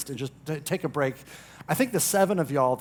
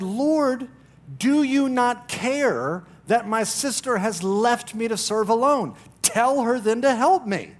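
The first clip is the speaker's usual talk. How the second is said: frustrated Martha; 6 semitones higher, 5 dB louder, slower than usual. Acted. Martha complaining to Jesus.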